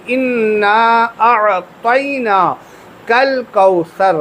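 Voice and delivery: slow chanted اِنَّا أَعْطَيْنَاكَ الْكَوْثَرَ; correct recitation. Shown as a demonstration. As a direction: stretch it out, each letter clear. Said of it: "یہ صحیح طریقہ ہے".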